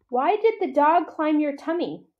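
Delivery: high, curious little voice; curious child. A narrator's reading. Ruby asks Bob a question, high and curious. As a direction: ask like a wide-eyed calf.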